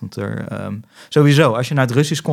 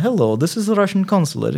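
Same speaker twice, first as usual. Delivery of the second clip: higher-pitched mock consulate greeting; indifferent Russian receptionist; 7 semitones higher than usual. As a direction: answer the phone as an indifferent Russian official. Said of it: "De onverschilligheid waarmee iemand je aanspreekt". (onverschillige Russische telefoniste)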